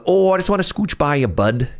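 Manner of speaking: imitating a friendly rural prairie Canadian accent. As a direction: lean into a folksy rural prairie Canadian accent, half joking. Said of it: "it almost sounds like Minnesota".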